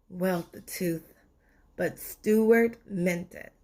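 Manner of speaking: ordinary delivery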